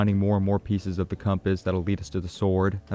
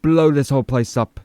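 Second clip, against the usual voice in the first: higher, louder threatening mock voice; mock tough guy; 6 semitones higher, 4 dB louder than usual. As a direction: imitate the paranoid guy barking a threat. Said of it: "he's like".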